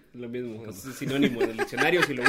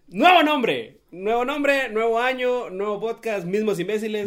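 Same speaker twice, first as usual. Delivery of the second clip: hype announcer; 7 semitones higher, 6 dB louder than usual. Acted. Hyped announcement of new podcast.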